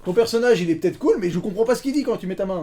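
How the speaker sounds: high, insistent exasperation; exasperated protest. Real, not acted. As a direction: protest loudly that it makes no sense. Exasperated at unintelligible muffled impression.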